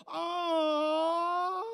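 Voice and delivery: high, drawn-out crying ah; imitating president. Comic imitating president's high emotional ah of agreement. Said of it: "he's like".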